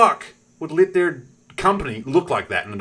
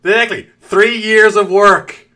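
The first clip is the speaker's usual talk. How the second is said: mock outrage; 7 semitones higher, 9 dB louder, slower than usual.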